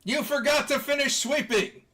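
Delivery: high, loud shout; in-character yell. Acted. Game master acts out angry character.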